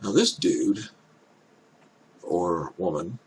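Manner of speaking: usual speaking voice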